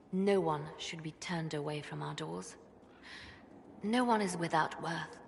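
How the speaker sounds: soft, low and hushed, slowing gently; solemn reassurance. Acted. Would-be Divine promises all are loved, hushed solemn reassurance. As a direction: console with quiet conviction, sinking almost to a whisper. Solemn, tender sermon-like assurance.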